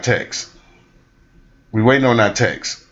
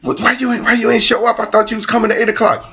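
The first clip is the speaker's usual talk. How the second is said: much higher, indignant questioning; stood-up partner's complaint; 11 semitones higher than usual. Retold or imitated. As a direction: ask it high and indignant.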